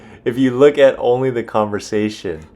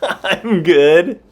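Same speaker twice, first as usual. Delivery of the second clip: high, loud, incredulous echo with laughter; cracking up in disbelief; laughing mock quote; 8 semitones higher, 7 dB louder than usual. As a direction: echo it through laughter, rising at the end. Laughing at the failed answer.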